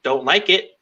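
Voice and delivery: normal talking voice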